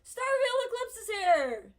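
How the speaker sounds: loud, high excited call; excited announcement. Real, not acted. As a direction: announce it with a burst of joy. Calling out a game event.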